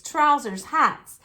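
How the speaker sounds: ordinary delivery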